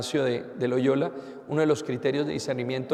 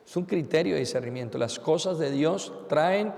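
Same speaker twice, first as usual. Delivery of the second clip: usual speaking voice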